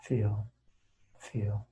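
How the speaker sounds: soft, low noting with long silences; meditation noting. Shown as a demonstration. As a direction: drop each word gently into the quiet. Demonstrates noting aloud during guided meditation.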